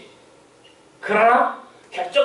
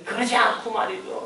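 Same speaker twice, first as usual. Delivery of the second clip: usual speaking voice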